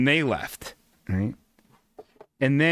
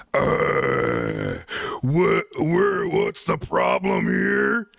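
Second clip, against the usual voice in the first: put-on big boyfriend; much slower than usual. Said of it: "And it was her boyfriend".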